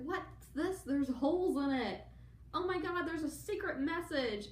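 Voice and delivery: high, rising shocked discovery; hammed-up Harry finding the message; mock astonishment. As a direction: gasp it out as the penny drops.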